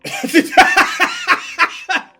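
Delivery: loud high-pitched laugh. Real, not acted. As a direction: burst out laughing. Laughing at difficulty.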